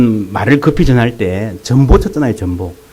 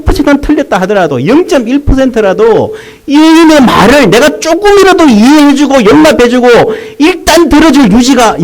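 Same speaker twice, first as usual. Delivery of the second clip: higher, full-force sermon delivery; impassioned preaching; 7 semitones higher than usual. Acted. Pastor urging the church to listen to others, impassioned. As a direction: preach it out, pleading with the congregation.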